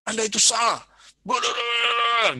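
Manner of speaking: high, loud scolding cop; mock police shouting. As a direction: bark it like an angry policeman. Polisi teriak-teriak sama Anda. (polisi membentak, tinggi dan keras)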